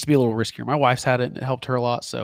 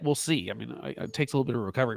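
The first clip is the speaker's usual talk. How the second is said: normal talking voice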